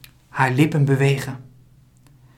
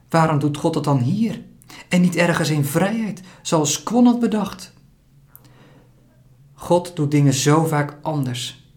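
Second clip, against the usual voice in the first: ordinary delivery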